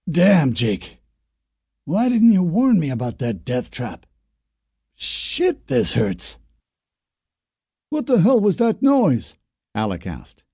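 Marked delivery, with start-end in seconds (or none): 0.1-6.4 a hurt man's higher, complaining voice; injured neighbour blaming Jake for the trap; pained burned man. Acted. 7.9-9.4 out of breath. Acted. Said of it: "gasping for breath".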